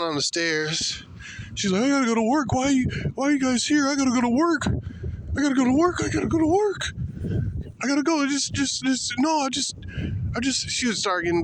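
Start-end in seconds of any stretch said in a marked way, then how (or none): 1.8-10.7 mock hysterical woman. Mocking the woman on the stairs, hysterical high whine. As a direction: wail it like a panicked woman, high. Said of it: "hysterical yelling".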